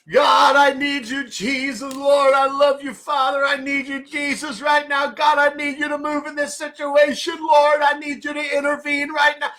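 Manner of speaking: high, loud, pleading cries to God; desperate shouted prayer. Retold or imitated. Preacher reenacting his prayer-room crisis, desperate shouting. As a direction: wail it out at the top of your voice. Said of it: "Man, I'm just going at it".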